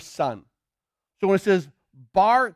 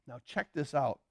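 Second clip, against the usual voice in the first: normal talking voice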